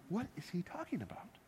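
a baffled Jesus, half to himself; genuinely confused. Retold or imitated. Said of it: "genuinely confused".

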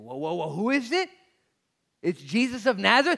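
high, loud, rising urgency; urgent excitement. Acted. Bartimaeus asking the crowd who is passing, urgent excitement. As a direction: build from startled question to loud news. Acted excited exchange in story.

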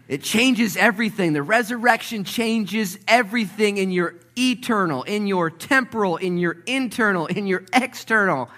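loud, high, driving sermon delivery; full-force preaching. Acted. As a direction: preach it out, building fast.

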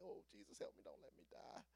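low, very soft plea; mock-terrified murmur; hushed desperate prayer. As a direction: pray under your breath for your life. Secret prayer while being lined up.

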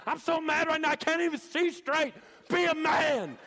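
losing his mind, screeching high and loud; crazed high-pitched coach. Retold or imitated. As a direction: scream it as a coach gone berserk. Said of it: "he went crazy and lost his mind".

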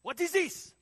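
loud, high-pitched shouted demand; angry screaming. Retold or imitated. Louis screams at teen cook, angry yell. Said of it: "So he's screaming at me".

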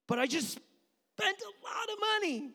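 very high, loud, drawn-out wail; comic cry of a dad pushed too far; exasperated outburst. Retold or imitated. Dad protesting to kids about ticket money, exasperated.

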